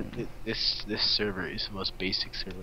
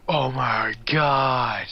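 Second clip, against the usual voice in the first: amazed gasp; 5 semitones higher, 6 dB louder, much slower than usual. Real, not acted. Sudden excited discovery in game.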